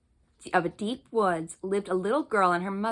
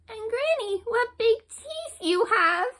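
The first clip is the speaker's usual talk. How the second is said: put-on higher, slow, wondering child; surprised little girl; 14 semitones higher, slower than usual. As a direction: marvel aloud like a small girl.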